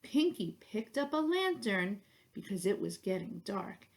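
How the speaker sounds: plain reading voice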